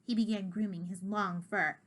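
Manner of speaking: usual speaking voice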